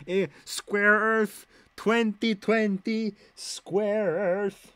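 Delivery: mock-stupid drawl on square Earth; mock dopey voice. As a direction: play dumb, dragging the words. Mocking the square earth idea.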